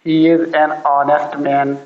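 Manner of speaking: slow, careful sentence showing an before honest; slow model sentence. Shown as a demonstration. Teacher models an example sentence slowly for students. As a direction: say each word slowly for learners. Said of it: "honest तो क्या लगाएंगे पीछे".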